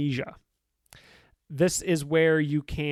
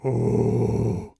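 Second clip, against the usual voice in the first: low booming howler monkey call; howler monkey impression; 4 semitones lower, 4 dB louder than usual. Shown as a demonstration. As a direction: imitate a howler monkey's roar. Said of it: "That was my best howler monkey impression".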